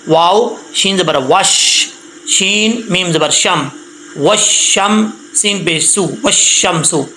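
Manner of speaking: slow syllable-by-syllable spelling of وش and شم; slow spelling drill. Shown as a demonstration. Teacher slowly spells the syllables for pupils. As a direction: spell out each letter slowly. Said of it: "spelling out syllables".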